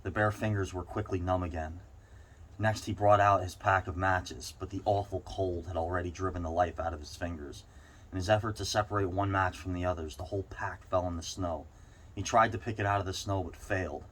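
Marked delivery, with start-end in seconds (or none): none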